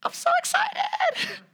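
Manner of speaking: put-on high-pitched childlike squeal; excited child. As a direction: gush like a thrilled little girl. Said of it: "She's like".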